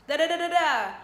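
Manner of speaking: loud, high mock yelling babble; mock loud rant; imitating a shouting partner. Shown as a demonstration. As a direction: play the one raising his voice. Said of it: "Imagine the voice is here".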